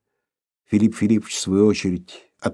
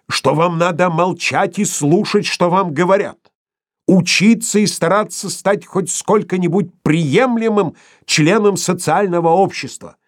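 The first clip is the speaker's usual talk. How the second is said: thundering; 8 semitones higher, 3 dB louder than usual. A narrator's reading. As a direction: drill each word into him. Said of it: "гремел Филипп Филиппович".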